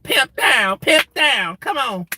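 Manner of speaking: high-voiced comic pimp calling out; Katt Williams as Money Mike. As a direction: ham up a high pimp hollering. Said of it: "you know, Money Mag the pimp".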